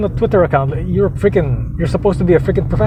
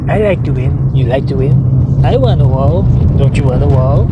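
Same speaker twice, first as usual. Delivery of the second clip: mock president voice, building louder; McDonald Trump; 4 dB louder than usual. Mock president asking crowd about a wall, swelling caricature. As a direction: caricature the president demanding things, getting louder. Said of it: "what he says was".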